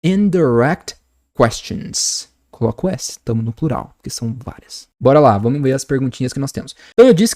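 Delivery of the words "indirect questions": very slow model of "Indirect questions"; drawn-out pronunciation demo; slow repeat-after-me. Shown as a demonstration. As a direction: sound out each syllable for a class to repeat. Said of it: "Repete aí comigo sempre".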